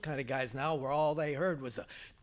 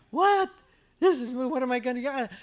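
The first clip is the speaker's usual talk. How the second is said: high, loud disbelieving outburst; stunned what; 8 semitones higher, 7 dB louder than usual. Retold or imitated. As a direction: react as if robbed of the job. Another guy hired instead.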